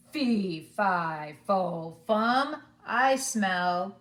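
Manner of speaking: loud giant chant, each syllable hit hard; booming giant. A narrator's reading. Giant sniffing out his visitor, loud and booming. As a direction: boom it out like a hungry giant. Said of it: "and said".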